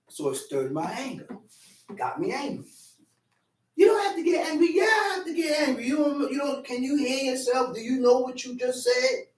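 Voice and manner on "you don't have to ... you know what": higher shouting back and forth; heated argument. Acted. Two men quarrelling over anger, shouted. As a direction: argue it out heatedly.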